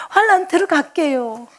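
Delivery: retold tearful plea to the Lord; crying promise. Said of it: "막 이랬어요".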